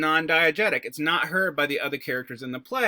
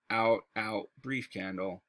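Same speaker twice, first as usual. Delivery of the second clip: low and softer, sinking into despair; dramatic recitation; 7 semitones lower, 5 dB quieter, slower than usual. Shown as a demonstration. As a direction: let the candle die out in the voice. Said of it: "let me give you a example of a very short soliloquy".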